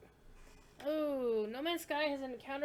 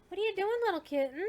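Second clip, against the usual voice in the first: cooing at a kitten; 9 semitones higher, 10 dB louder, faster than usual. Real, not acted. Talking to kitten in baby voice.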